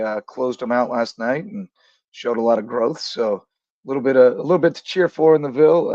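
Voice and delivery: ordinary talk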